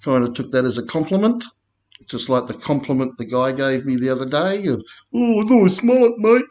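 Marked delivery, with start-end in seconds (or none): 5.1-6.4 high, put-on bloke's voice, much higher; mimicking a stranger. Stranger in the street praising his mullet, high mimicked voice. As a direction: toss off the compliment like a passing stranger.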